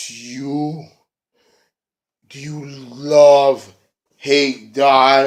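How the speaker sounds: slow, lightly voiced creepy masked figure; creepy Willow voice. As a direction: drag it out like a creepy riddle. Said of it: "And then he's like".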